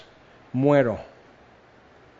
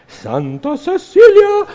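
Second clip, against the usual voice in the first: mock awe; 8 dB louder, slower than usual. Mock awe listing famous saints.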